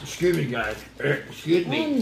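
prim excuse me. Retold or imitated. Apologizing to viewers after burping, low and soft. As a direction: hush it out like a dainty on-camera apology. Mock polite burp apology.

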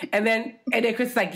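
ordinary talk, nothing marked

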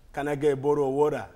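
put-on American accent with a loud man tag; fake American accent. A traveler in New York faking an American accent. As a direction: ask for water in mock American English. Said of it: "Use the accent".

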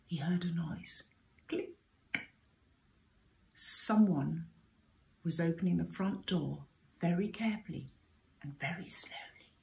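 soft, hushed suspense telling; storyteller hushing for the creeping intruder. A narrator's reading. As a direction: hush it to build suspense. Suspenseful noise at the door.

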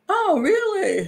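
high, doubtful "oh really"; high, faintly sarcastic disbelief; mock surprise. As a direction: sound surprised but not believing it.

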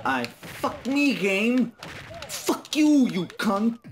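real gamer rage, screaming curses; furious outburst. Real, not acted. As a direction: explode at the screen, pitch shooting up. Raging at losing a game match.

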